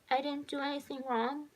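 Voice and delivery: put-on high little-girl question, rising at the end; child asking. A narrator's reading. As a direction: ask it unsurely, like a tearful child. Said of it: "the little girl asked the boy".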